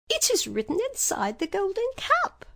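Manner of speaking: very high tiny voice; little mouse explaining; squeaky mouse queen. A narrator's reading. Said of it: "replied the Queen of Mice".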